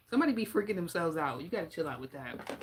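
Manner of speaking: ordinary talk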